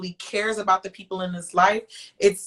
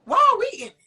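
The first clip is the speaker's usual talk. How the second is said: exasperated; 13 semitones higher, 4 dB louder, much faster than usual. Pretend. Exasperated question to [name].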